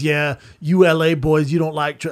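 usual speaking voice

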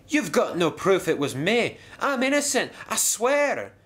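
defensive, high-pitched denial; flustered protest. Acted. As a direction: pitch climbing into a high squeak. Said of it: "he stammered".

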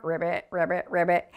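deep, soft croaking ribbit; frog croak. Shown as a demonstration. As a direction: croak it low like a little frog. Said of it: "Can you ribbit like a frog".

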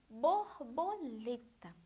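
"borboleta" drawn out syllable by syllable; slow syllables. Shown as a demonstration. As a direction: stretch "borboleta" into slow, distinct beats. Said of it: "Vejam bem". ("borboleta" bem devagar, marcando as sílabas)

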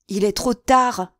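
loud, slow wail of despair; despairing cry. A narrator's reading. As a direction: cry out as if all is lost. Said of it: "dit Mazarin en s'arrachant les cheveux".

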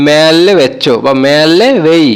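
মেলে বেচ্চো said slowly and carefully; slow model phrase for learners; carefully paced Malayalam demo. Shown as a demonstration. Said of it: "Malayalam হবে".

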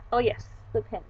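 ordinary delivery

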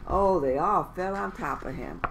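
plain reading voice

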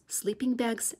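usual speaking voice

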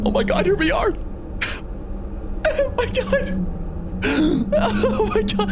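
high, squealing oh my gods between laughs; overjoyed squeal. Real, not acted. Streamer reaching the new area, high laughing squeal. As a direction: squeal with laughing near-tearful joy. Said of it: "I'm gonna be crying a lot during this".

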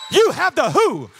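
loud, punched preaching line; forceful proclamation. Real, not acted. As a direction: proclaim it firmly to the congregation. First take of climax line.

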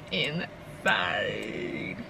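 very low, soft, drawn-out creak; low groan. Real, not acted. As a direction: let the word sag into a weary groan. Weary repeat of complaint.

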